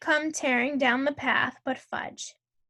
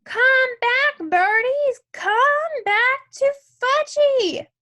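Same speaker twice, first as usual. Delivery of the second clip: very high, loud, drawn-out yell; shrieky small-child shouting; screaming toddler; 11 semitones higher, 7 dB louder, much slower than usual. A narrator's reading. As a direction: scream after the birds like a little boy. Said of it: "was screaming".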